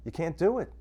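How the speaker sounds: ordinary talk, nothing marked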